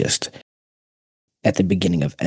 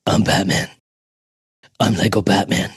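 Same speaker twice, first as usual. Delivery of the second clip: Batman voice. Pretend. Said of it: "I have a Lego Batman".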